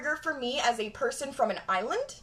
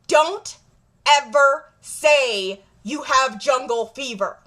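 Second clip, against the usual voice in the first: normal talking voice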